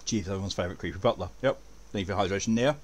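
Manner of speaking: normal talking voice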